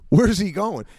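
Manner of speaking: high-pitched mock bewilderment; bewildered scroll operator. Scroll operator losing Biden's place, comically bewildered. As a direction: ask it as if you've completely lost track.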